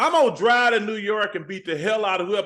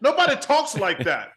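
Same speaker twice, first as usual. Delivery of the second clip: laughing; 7 semitones higher than usual. Retold or imitated. Laughing protest at directors.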